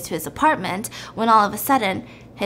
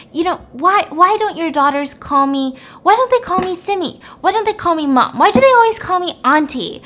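upset; 7 semitones higher, 4 dB louder than usual. Retold or imitated. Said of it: "she's just a little bit upset".

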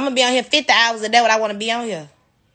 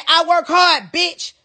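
repeating her catchphrase; 6 semitones higher, much slower than usual. Retold or imitated. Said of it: "Y'all remember when I said".

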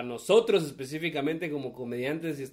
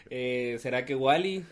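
ordinary talk, nothing marked

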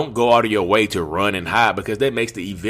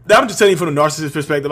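ordinary delivery

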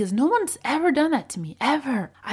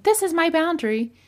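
mock harsh declaration; 9 semitones higher, 3 dB louder, faster than usual. Shown as a demonstration. Harsh out-of-control example.

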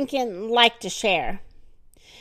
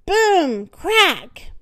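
dramatic boom; 6 semitones higher, 4 dB louder than usual. A narrator's reading. Floor cracks again.

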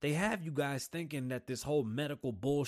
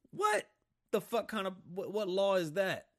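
sudden very high, loud "What"; shocked, outraged question; incredulous outburst; 8 semitones higher, 4 dB louder than usual. Real, not acted. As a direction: explode in disbelief. Outraged disbelief.

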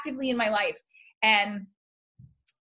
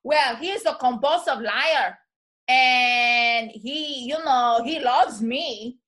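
slow, hammed-up Puerto Rican wife; thick Puerto Rican accent; 5 dB louder, much slower than usual. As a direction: imitate her thick Puerto Rican accent, stretching the words. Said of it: "she has this thick, thick accent".

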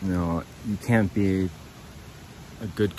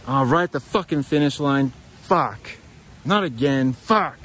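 higher-pitched exasperated cursing; acted frustration; 5 semitones higher, 3 dB louder than usual. Runner imagining a finish-line setback, acted frustration. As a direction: curse your bad luck, voice jumping up.

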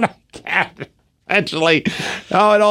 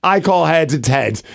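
usual speaking voice

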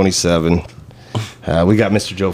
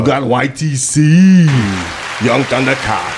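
hype announcer; 4 semitones higher, slower than usual. Acted. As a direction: announce like a ring MC. Emcee announcing guest.

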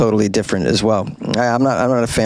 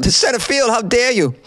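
mock outrage; 9 semitones higher than usual.

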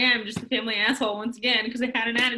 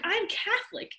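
much higher, pleading whine; whiny demand; 9 semitones higher than usual. Retold or imitated. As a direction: wheedle it like a spoiled child.

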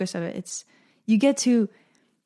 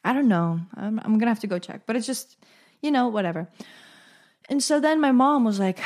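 normal talking voice

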